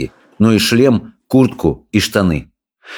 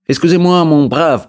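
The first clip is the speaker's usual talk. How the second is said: high, hesitant French; lost boy trying French, pitched up; flustered politeness; 8 semitones higher than usual. A narrator's reading. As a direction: stammer out polite French, bewildered. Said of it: "растерянно заговорил он".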